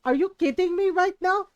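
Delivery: mock motherly outburst; exasperated mom. Mom fed up with a measuring question, exasperated. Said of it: "And my mom goes".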